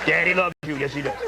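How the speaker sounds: muffled, spoken into a mug; talking into a mug. Real, not acted. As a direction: mumble into a coffee mug. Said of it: "Stop talking into your mug".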